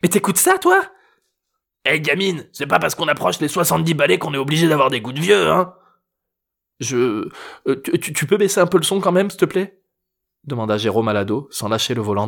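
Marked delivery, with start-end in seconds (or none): 0.0-0.8 put-on incredulous teen girl. A narrator's reading. Teen girl scolding grandfather. 1.9-5.7 high, teasing grandfather voice; cheeky old man. A narrator's reading. 6.8-9.8 high, tense stammering request; nervous stammer. A narrator's reading. Tense driver asks, stammering.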